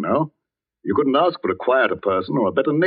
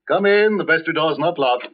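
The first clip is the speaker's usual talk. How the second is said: higher-pitched call through a door; 6 semitones higher than usual. Acted. Vicar answers the knock at the vestry, calling out. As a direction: invite the visitor in from across the room.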